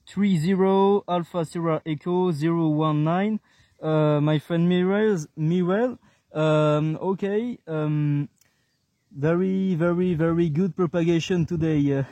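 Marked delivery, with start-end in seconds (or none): none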